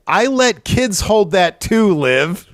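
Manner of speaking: high, slow sneering taunt; high mocking drawl; mocking putdown. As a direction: drag it out to belittle her. [name] mocking Liv's claim.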